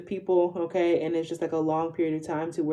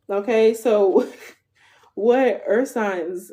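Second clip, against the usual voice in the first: slow words broken by laughter, louder; laughing it off; 3 semitones higher, 6 dB louder, much slower than usual. Real, not acted. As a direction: laugh through the words while regaining composure. Laughing after the accent slip.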